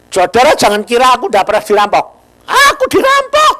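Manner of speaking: loud, fast outburst about the robbery; loud exclaiming. Real, not acted. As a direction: burst out high and loud. Preacher recounting being robbed.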